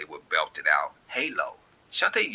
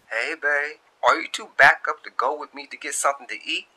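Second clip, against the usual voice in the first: higher, a bit louder, sweet invite to lunch; lightly higher girlfriend on the intercom; Shantae voice; 8 semitones higher, 5 dB louder than usual. Acted. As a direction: call your man to lunch. Shantae buzzing Darius' office.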